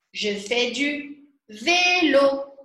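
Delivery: slow, clear teacher read of "Je fais du vélo"; slow model sentence. Shown as a demonstration. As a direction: say it slowly so learners can copy. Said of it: "Par exemple".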